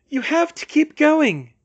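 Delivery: high, loud plea; distressed urging. A narrator's reading. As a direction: call out urgently, voice pitched high. Hermione urging Harry on, distressed.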